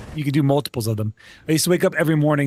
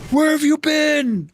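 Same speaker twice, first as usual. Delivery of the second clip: slow, much higher put-on voice, demanding; mock angry officer; 12 semitones higher, 3 dB louder, much slower than usual. Warrant officer confronting the soldier who overslept, mock angry. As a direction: drag it out like an angry boss. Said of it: "he got really mad at me".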